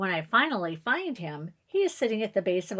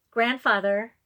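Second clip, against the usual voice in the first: louder call, slightly raised; a little louder; 3 semitones higher, 6 dB louder than usual. A narrator's reading. Girl waking sleeping great-grandfather, a bit louder. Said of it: "I say a little bit louder".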